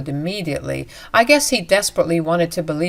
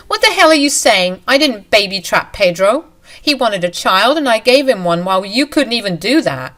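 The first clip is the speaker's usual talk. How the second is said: outraged shout; 3 semitones higher, 4 dB louder than usual. Acted. Mistress answering the wife's accusation, outraged shout. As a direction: hurl it back, stung.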